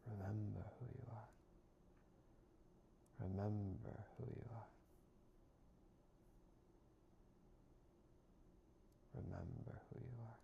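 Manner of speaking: a soft, repeated meditative mantra; gently intoned affirmation. Acted. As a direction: let each repetition settle like a reminder. Repeats mantra with long pauses.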